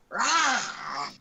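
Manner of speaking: short playful roar; mock dinosaur roar. Shown as a demonstration. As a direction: growl out a quick Rah. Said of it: "Or something like that".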